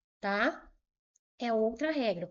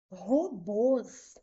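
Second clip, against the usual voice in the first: "Robôs" split into ro-BÔS, weight on BÔS; stress on final syllable. Shown as a demonstration. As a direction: say "Robôs" in two separate syllables, leaning on the last. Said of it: "A última sílaba é mais forte". ("Robôs" separado, com força no BÔS)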